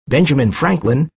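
ordinary delivery

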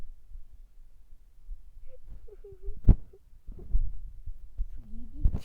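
long breathy jeering laugh; mocking laugh. Friends laughing at the broke girl. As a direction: laugh her out the door.

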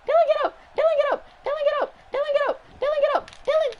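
high, loud repeated squeal; frantic yelling. Real, not acted. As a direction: urge a downed fighter up, shrieking it over and over. Yelling at downed game character.

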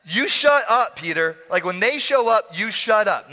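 high, insistent telling-off; playful, emphatic reprimand; mock scolding. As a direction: drive the order home in comic exasperation.

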